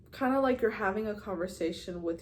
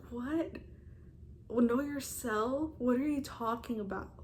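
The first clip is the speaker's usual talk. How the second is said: higher-pitched, incredulous questioning; baffled retort; 4 semitones higher, 3 dB quieter than usual. Retold or imitated. Younger self not understanding self-love advice, incredulous. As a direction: act out total bafflement, pitch up.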